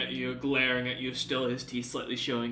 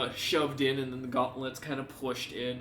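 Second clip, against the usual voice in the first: usual speaking voice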